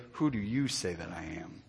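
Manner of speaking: soft, low and grave; hushed solemn question. Acted. Preacher voicing God, hushed and low. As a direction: let God's question land quietly.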